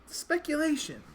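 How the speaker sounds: high, loud segment announcement; announcing. Real, not acted. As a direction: announce it like a game show host.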